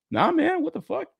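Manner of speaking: high, mock-shocked neighbor; neighbor imitated. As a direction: play the outraged neighbor. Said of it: "And he was like".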